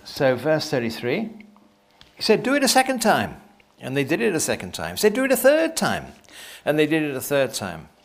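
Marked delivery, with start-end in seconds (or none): 2.4-3.4 high, firm order; commanding. Acted. Elijah ordering the men to soak the wood again, high firm command. 5.1-6.1 high, firm order; commanding. Acted. Elijah telling the men to soak it a third time, high firm command.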